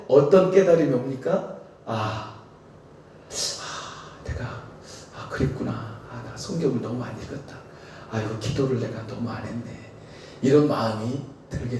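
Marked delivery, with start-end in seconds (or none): 1.9-9.9 soft, breathy dawning regret; whispered remorse, half to yourself; hushed self-reproach. Retold or imitated. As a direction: murmur it as a sudden realization, quietly. Preacher voices believer's realization.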